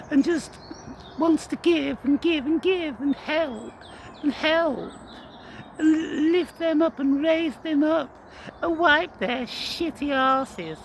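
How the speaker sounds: high, slow syrupy mockery; mocking sweetness. Sneering at a kind replacement, high syrupy mock. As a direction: parody a saintly do-gooder.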